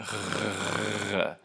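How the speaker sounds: buzzing French R rasp; throaty rasp with the voice on; voiced uvular fricative. Shown as a demonstration. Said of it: "like that when I make it".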